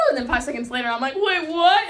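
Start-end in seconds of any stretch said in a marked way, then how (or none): 1.2-1.9 higher, louder wait, what; startled double take. Retold or imitated. Girl suddenly realizing the news, surprised and louder.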